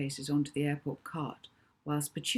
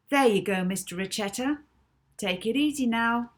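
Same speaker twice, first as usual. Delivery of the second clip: breezy Molly; 6 semitones higher, 6 dB louder than usual. Acted. Molly hands over car.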